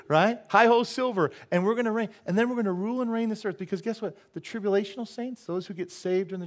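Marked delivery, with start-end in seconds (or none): 0.5-1.3 cowboy cry. Pretend.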